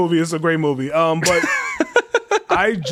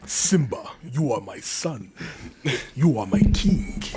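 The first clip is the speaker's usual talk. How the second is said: put-on soft, slow, regal African accent; a gentle king with an African accent, barely above a murmur; [name]'s African accent; 5 semitones lower, 5 dB quieter, slower than usual. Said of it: "That's a different accent".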